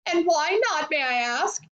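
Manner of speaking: high, indignant challenge; mildly shouted retort. A narrator's reading. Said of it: "shouted Missus Globe".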